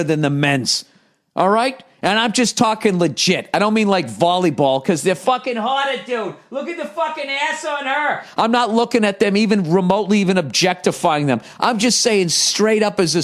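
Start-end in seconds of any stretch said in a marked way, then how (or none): 5.1-8.2 high, softened ogling voice; hammed-up leer, pitched up and hushed; mock ogler. Comedian joking about women's volleyball, mock ogling voice.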